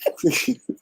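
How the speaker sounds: high, soft laugh; light burst of high, voiceless laughter. Real, not acted. Panelist amused at the speechless colleague, soft high laugh. Amused that she cannot answer.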